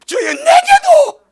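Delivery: very high, slow, loud cry; shouted plea. Retold or imitated. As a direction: cry out to heaven, stretched long. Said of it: "누가 은혜로 뱀고침".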